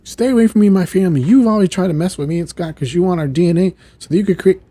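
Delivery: loud, high, rushed accusation; imitated indignant outburst; angry warning. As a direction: warn off an enemy in acted fury. Acted quarrel with Sinister.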